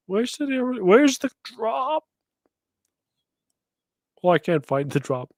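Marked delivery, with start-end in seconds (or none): none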